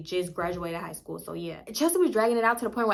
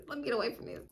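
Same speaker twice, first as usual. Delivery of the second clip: much softer repeated aside; under the breath; 2 semitones higher, 11 dB quieter, faster than usual. Retold or imitated. Guest slipping away from the family fight, hushed. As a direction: say it to yourself while backing away.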